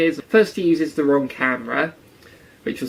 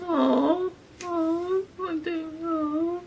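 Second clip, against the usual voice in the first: much higher, soft, slow, near-tearful cooing; mock sympathetic; 6 dB quieter, much slower than usual. Mocking Misty's sudden sympathy for Pikachu, teary coo. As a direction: coo like she might cry. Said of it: "She's suddenly all sympathetic".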